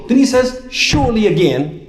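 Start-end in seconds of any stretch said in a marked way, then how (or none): 0.7-1.3 SURELY high and forceful once more; emphatic repetition. Shown as a demonstration. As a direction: land hard on a high-pitched SURELY. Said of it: "When do we emphasize?".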